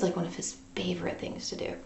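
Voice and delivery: unvoiced aside whisper. Real, not acted. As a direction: whisper it like a secret.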